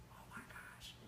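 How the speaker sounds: soft voiceless whisper; Jay on Monday with no voice, whispering. Retold or imitated. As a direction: breathe it with no voice. Voice gone Monday morning.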